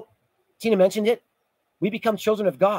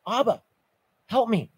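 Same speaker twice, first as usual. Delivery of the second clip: pleading cry, pitched up at first; 4 semitones higher than usual. Acted. Preacher voicing struggling believers calling on God, crying out. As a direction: cry out to a father for rescue. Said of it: "that's why we cry out".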